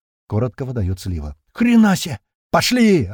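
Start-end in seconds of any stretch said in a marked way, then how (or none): 1.5-3.1 high, loud wild roar; roaring like a bison. A narrator's reading. April roars at the squad to run, loud high bellow. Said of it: "ревёт раненым бизоном Апрель".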